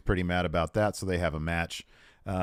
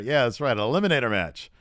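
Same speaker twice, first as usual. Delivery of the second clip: ordinary talk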